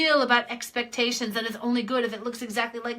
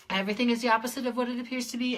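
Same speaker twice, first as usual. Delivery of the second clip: rapid recital; much faster than usual. Shown as a demonstration. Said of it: "Use this phrase".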